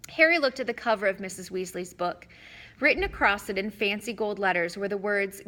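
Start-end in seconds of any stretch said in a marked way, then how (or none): none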